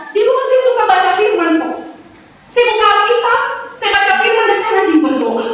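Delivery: a lightly voiced mother pleading her case; mother making excuses. Church mother excusing herself to pastor.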